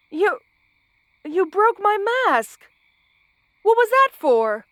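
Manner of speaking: high, startled outrage; shocked. Acted. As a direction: caught off guard, voice jumping up. Mask suddenly broken.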